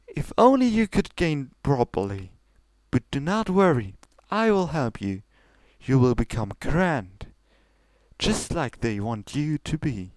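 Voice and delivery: high, regal princess voice pushing her sister; lofty royal sister, coaxing; Celestia's voice. Acted. Older sister pressing Luna to go on, high and regal.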